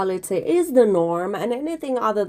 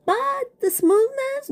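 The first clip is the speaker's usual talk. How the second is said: high sassy voice; slower than usual. Acted. Sassy aside about filter.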